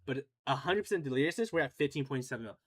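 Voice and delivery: ordinary delivery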